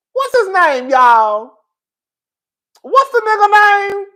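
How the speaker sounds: high, loud call with the "Twix or Twitch" drawn out slowly; calling out to chat. Real, not acted. Host loudly asks her viewers the name, high and drawn out. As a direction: shout the question out to the room.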